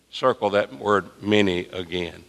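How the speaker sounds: ordinary delivery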